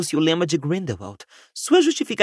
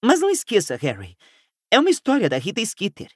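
higher-pitched, insistent start that falls away; higher voice, gently reminding; lightly voiced Hermione; 6 semitones higher than usual. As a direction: lightly voice an earnest friend.

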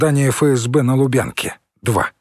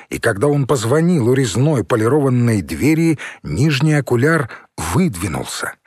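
usual speaking voice

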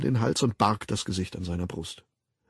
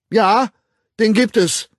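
loud declaration; 11 semitones higher, 11 dB louder than usual. Acted. Bernard answering the director loudly and defiantly. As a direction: blurt it out loud and high. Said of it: "erklärte Bernard lauthals".

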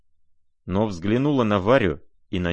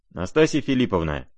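plain reading voice

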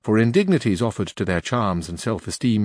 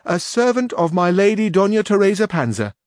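high, louder page voice; young page voice, raised and loud; 10 semitones higher, 5 dB louder than usual. A narrator's reading. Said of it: "replied the page".

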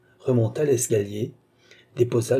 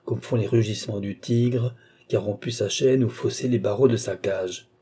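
ordinary delivery, nothing marked

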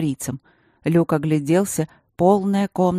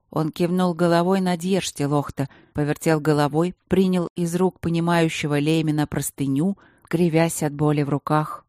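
plain reading voice

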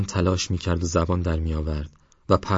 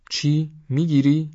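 high-pitched disbelieving questions; incredulous; 9 semitones higher, 3 dB louder, slower than usual. A narrator's reading. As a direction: question it in sharp surprise, voice jumping up. Friend startled at snake-catching plan. (با تعجب و ناباوری)

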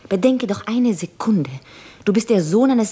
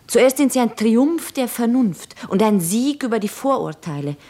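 normal talking voice

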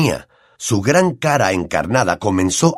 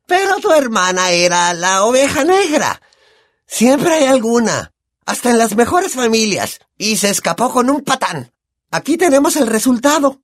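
high, brash, boozy aunt voice; Aunt Marge; 12 semitones higher than usual. Acted. Aunt sneers at the family over brandy, high brash voice.

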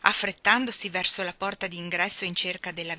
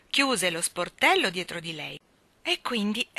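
usual speaking voice

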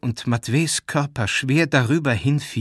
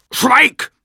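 very high, loud one-word command; enraged scream; 11 dB louder than usual. A narrator's reading. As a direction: cut him off with a scream. Jakow silences him in rage. (gellend, wütend schreien)

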